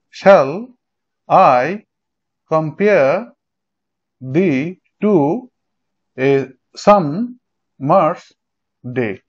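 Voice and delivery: very slow "Shall I compare thee to a summer's day?", broken into separate beats; stressed syllable demonstration. Shown as a demonstration. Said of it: "জোরে উচ্চারণ হবে। যেমন".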